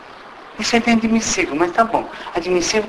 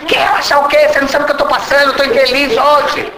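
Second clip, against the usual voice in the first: namorada irritada; 7 semitones higher, faster than usual. Retold or imitated.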